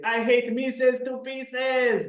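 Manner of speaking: high, very slow cartoon cat voice; cartoon catchphrase. Teacher greeting an adult in a cartoon shirt with the cat's line. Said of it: "I say".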